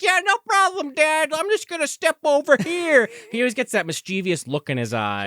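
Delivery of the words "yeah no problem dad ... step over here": high, sweet fake-innocent kid voice; mock-sweet child voice, pitched up. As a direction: play the sly kid acting innocent. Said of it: "And he's like".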